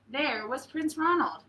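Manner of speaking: ordinary delivery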